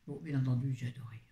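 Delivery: confiding whisper. Real, not acted. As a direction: share it like a secret. Confiding her love of book.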